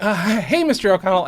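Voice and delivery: mock-confident hello; eager fan greeting. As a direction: play it casual as if you'd been there all along. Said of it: "I was like".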